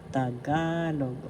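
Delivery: "Tagalog" slowly, with GA drawn out; accent on second syllable. Shown as a demonstration. Teacher repeating "Tagalog" for learners, slow with stressed GA. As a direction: lean on the middle syllable of "Tagalog". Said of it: "The accent is in the second syllable".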